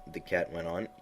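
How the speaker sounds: ordinary delivery, nothing marked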